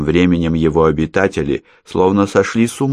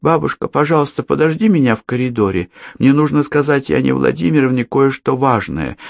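put-on purring girl; 8 semitones higher than usual. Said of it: "промурлыкала девочка".

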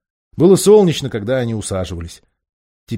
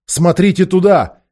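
higher, slow insistent shout; shouting louder; 9 semitones higher, 8 dB louder than usual. A narrator's reading. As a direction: point and shout urgently. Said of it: "вскричал он".

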